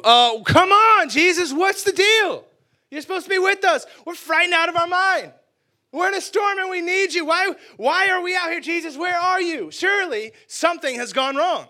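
high, loud, frightened wail; frightened disciples crying out; desperate pleading. Acted. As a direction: beg Jesus to come back.

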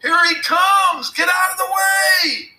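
high, loud alarmed shout; yelling. A narrator's reading. Alan and Joe fleeing up the hill, yelling. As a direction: shout a warning at full voice.

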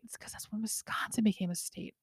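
whispered joke aside; hushed conspiratorial aside. Real, not acted. Joking aside in history podcast.

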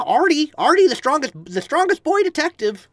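put-on high-pitched little boy voice; high child character voice; boy detective. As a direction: announce it as a proud kid detective. Names another child character in voice.

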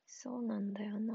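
soft unvoiced whisper to herself; quietly whispered reflection; whispered aside. Real, not acted. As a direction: breathe it out almost to yourself, wistful.

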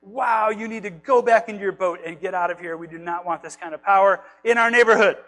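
loud, insistent send-off, building to the end; mock alarmed townspeople. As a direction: play a townsperson loudly warning him off. Townspeople ordering Jesus away.

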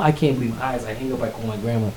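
normal talking voice

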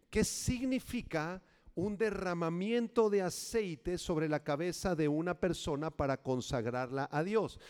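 ordinary delivery

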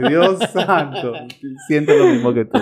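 high, laughing exclamation; breaking up with laughter. Real, not acted. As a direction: laugh through the words. Laughing at friend's story.

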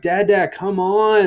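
put-on high, eager little-girl voice; toddler pleading. Two-year-old pulling dad off the couch, eager. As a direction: tug at dad with the words. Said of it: "she's like".

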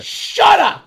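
high-pitched yell to silence someone; piercingly high, drawn-out outburst; retold angry yell. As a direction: imitate an exasperated heckle-back. Crowd member's outburst, continued.

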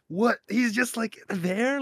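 high-pitched starstruck gasp and disbelief; awestruck kids. Retold or imitated. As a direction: imitate a child stunned at seeing a celebrity. Kids awestruck on seeing Santa.

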